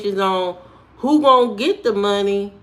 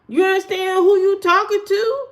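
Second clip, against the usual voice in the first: sassy challenge; 11 semitones higher, 3 dB louder than usual. Pretend. Boasting at a commenter.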